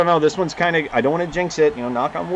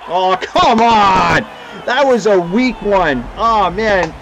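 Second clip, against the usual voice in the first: loud, drawn-out angry groan; frustrated outburst; 5 semitones higher, 5 dB louder, much slower than usual. Real, not acted. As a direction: let the disgust out. Goal conceded.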